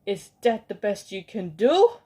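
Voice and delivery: loud sneering challenge; mock their poor effort; scornful demand. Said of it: "cried out".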